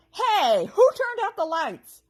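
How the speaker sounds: high, loud whiny goblin; goblin wail. A narrator's reading. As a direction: wail it into the dark. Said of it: "wailed one of the goblins".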